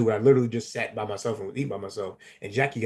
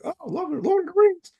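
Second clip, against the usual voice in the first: much higher, excited; new friends bonding over the films; gushing fan; 4 dB louder than usual. Retold or imitated. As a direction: squeal it like a fan. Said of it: "we're like".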